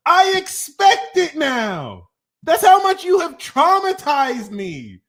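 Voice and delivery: loud, slow, high exasperated rant; exasperated shout. Real, not acted. Ranting at Pisces viewers, loud and exasperated. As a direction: throw the words out in disbelief.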